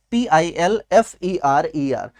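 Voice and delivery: letters of पिल्फरर spelled out slowly, one by one; slow spelling out. Shown as a demonstration. Teacher spelling पिल्फरर for students, slow letters. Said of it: "spelling the word out".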